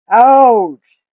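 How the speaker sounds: high, loud yelp of pain; pained ouch. A narrator's reading. The giant turtle stabbed by a sword, yelping Ouch.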